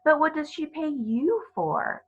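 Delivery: put-on a child pressing for an answer; curious girl.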